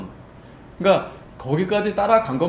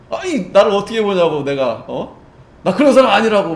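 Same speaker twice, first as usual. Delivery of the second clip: louder, higher, offended protest; indignant denial; 5 semitones higher, 9 dB louder than usual. Retold or imitated. Peter turning on his accusers, indignant and loud. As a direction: bristle as if the accusation insults you. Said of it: "아주 정색을 하면서".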